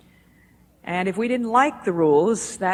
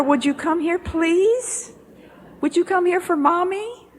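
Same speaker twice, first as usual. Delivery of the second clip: put-on high, louder, pleading calls to a toddler; mother pleading with child; 9 semitones higher, 5 dB louder than usual. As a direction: keep calling her name, higher and more desperate. Mother calling child at carousel.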